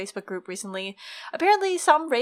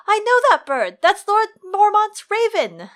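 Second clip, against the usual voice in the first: high, loud shout; excited shout; 10 semitones higher, 7 dB louder than usual. A narrator's reading. Samwell spots the raven in the hall, shouting. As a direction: call it out across a hall. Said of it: "John heard Samwell Tarly shout".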